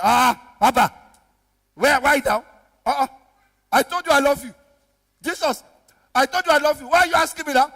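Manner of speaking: loud, exasperated protest; angry. Acted. Peter fed up at being asked a third time, angry. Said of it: "he got angry".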